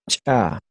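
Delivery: "cha" with CH popped sharply before the vowel; explosive CH. Shown as a demonstration. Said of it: "y la explosiva, explotando".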